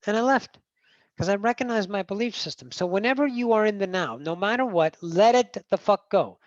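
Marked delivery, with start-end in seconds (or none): none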